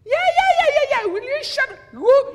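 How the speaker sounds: a dad who's had enough, yelling at his son; mock angry yell. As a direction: explode at the kid, half joking. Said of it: "he makes me mad and I'll be like".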